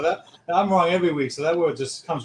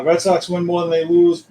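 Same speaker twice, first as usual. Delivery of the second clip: ordinary talk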